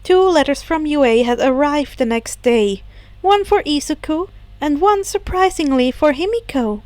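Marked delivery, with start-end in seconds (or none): none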